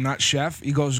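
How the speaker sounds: ordinary talk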